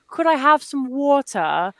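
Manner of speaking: mock English accent, "water" without the R; English gentleman. English gentleman asking a stranger for water, imitated English accent. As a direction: put on a polite English accent. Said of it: "English gentleman came to me and was like".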